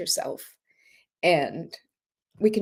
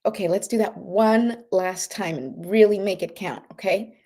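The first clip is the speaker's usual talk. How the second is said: guided breathing exercise. Acted. Leads breathing meditation.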